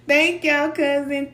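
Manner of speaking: high and loud through a laugh; giddy laughing thanks. Real, not acted. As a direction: thank them while still laughing. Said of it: "I was getting beside myself".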